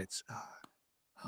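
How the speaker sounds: whispered, tongue-tied stammer; starstruck stutter. Retold or imitated. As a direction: lose your words in awe. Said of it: "now I'm stuttering".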